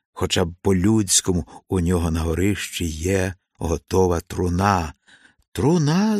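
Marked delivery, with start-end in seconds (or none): 5.5-6.2 high, rising astonished question; startled one-word query; surprised. A narrator's reading. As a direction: echo the word in disbelief, pitch leaping up. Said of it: "здивувався Ворон".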